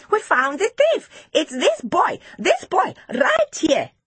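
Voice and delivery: put-on high, urgent child's voice; excited, high-pitched tattling; cried-out accusation. A narrator's reading. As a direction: point out the culprit in high, punchy bursts. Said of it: "cried Tapiwa".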